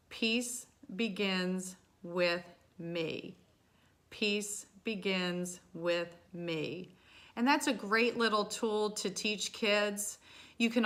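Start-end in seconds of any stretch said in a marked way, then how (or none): 0.1-6.9 very slow, spaced-out mantra; chant-like, one word at a time, unhurried. Shown as a demonstration. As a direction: say each word slowly and evenly, letting it settle. Repeats the mantra with finger taps.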